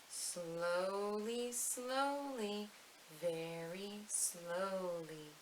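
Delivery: drawn out, soft and very slow like a creeping snail. Shown as a demonstration. Teacher reciting to little ones, slow and soft. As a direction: stretch each word out slowly and softly. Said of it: "say the slowly snail part slowly".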